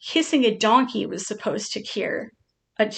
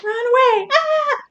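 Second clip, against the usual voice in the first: much higher and louder, a squealing mock panic; panicked turtle; 6 dB louder than usual. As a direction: voice the pet fleeing in cartoon terror. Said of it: "You're like".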